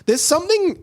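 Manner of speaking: usual speaking voice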